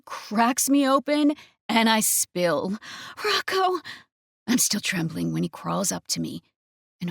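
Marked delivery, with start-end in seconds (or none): 3.2-3.8 high ecstatic shout of his name; climax cry. Acted. Cries out at climax.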